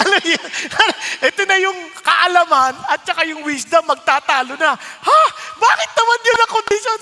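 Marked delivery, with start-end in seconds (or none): 4.8-7.0 high, loud, indignant "Ha?"; loud protest. Retold or imitated. Preacher argues with God about the condition, loud indignant protest. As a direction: protest the condition at the top of your voice.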